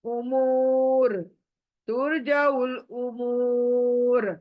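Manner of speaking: उमूर stretched slowly, final र silent; drawn out stop. Shown as a demonstration. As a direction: pull the long ू of उमूर and stop. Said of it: "खींच कर stop करूंगी".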